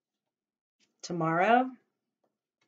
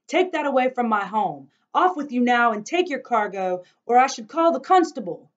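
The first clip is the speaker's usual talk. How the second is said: loud, higher angry orders; angry shouting; 7 semitones higher, 11 dB louder than usual. Acted. Grandfather ordering the cart man away, loud angry shout. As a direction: drive him off with a shout.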